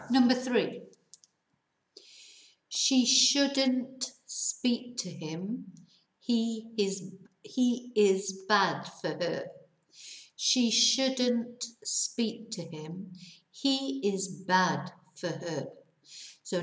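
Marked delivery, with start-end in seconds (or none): 2.7-9.5 "She shouldn't speak to him" spoken very slowly, word by word; slow reading. Shown as a demonstration. Teacher reads the example very slowly to students. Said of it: "voy a leerlo, lento". 10.4-15.7 "She shouldn't speak to him" spoken very slowly, word by word; slow reading. Shown as a demonstration. Teacher reads the example very slowly to students. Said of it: "voy a leerlo, lento".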